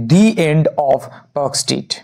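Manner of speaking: the said "dee" before end, slowly; slow phrase, long "ee" in the. Shown as a demonstration. Teacher models the vowel rule slowly, the as "dee". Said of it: "vowel আছে".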